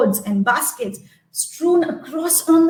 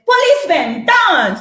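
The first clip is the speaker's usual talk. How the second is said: higher, louder command; farmer's command; 6 semitones higher, 4 dB louder than usual. Acted. Farmer ordering the policemen, loud higher command. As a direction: shout the order.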